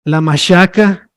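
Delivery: la machaca with ch softened to sh, mashaca; northern Mexican pronunciation. Shown as a demonstration. Teacher giving a northern example, machaca as mashaca. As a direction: say la machaca slowly, sh in place of ch. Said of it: "en el norte".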